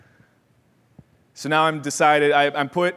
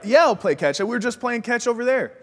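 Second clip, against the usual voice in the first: higher, bright eager reply; eager kid yes; 8 semitones higher than usual. Retold or imitated. Boy answering his dad's offer of catch, higher and eager. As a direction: jump at the offer like a relieved kid.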